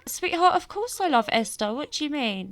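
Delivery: high-pitched, put-on protest of innocence; bewildered protest. Dad protesting to his child that he loves Esther, bewildered. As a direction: leap up high, acting taken aback.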